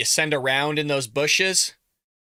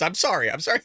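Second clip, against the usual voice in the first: ordinary talk, nothing marked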